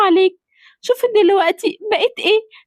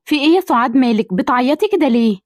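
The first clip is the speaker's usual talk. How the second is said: much lower, gentle motherly voice; lower-pitched mother greeting her son; older mother voice; 9 semitones lower than usual. Acted. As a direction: speak as the doting mother.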